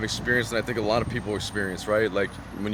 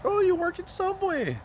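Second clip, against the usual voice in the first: mock high quote. People asking if he works there.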